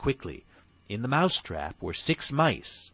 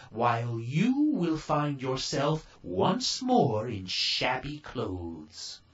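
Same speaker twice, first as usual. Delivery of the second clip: high, slow, solemn fairy warning; fairy godmother warning; 5 semitones higher, slower than usual. A narrator's reading. Fairy godmother warning Cinderella about midnight, high and slow.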